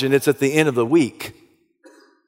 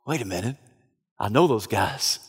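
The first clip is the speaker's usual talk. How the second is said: much softer, low and breathless; hushed realization; 6 semitones lower, 8 dB quieter than usual. Acted. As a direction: murmur it as recognition dawns. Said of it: "he thinks to himself".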